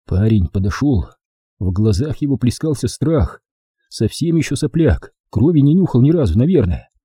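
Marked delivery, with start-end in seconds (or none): none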